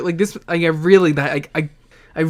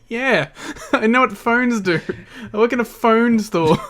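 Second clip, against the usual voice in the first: higher, laughing mock clerk reply; laughing through it; 7 semitones higher than usual. Cohost voicing the weary phone clerk, laughing. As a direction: crack up while voicing the clerk. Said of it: "And he's like".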